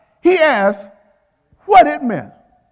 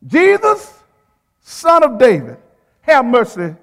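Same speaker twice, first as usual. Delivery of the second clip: raised, pleading cry to Jesus; 3 dB louder, slower than usual. Acted. Blind beggar calls out loudly to Jesus for mercy. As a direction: call out loud for mercy. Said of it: "he cried out".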